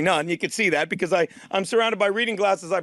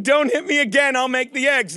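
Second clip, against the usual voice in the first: ordinary talk, nothing marked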